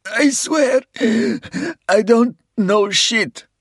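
high, loud, desperate plea; terrified pleading. A narrator's reading. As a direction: beg for your life in panic. Wounded captive pleads under torture.

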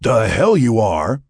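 heated, drawn-out refusal; angry objection. A narrator's reading. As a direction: flatly forbid it, leaning on "hell". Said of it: "he objected".

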